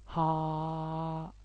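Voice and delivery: ها held long and breathy with mouth open; long open H sound. Shown as a demonstration. Teacher showing the H sound, long ها. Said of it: "صوت طويل".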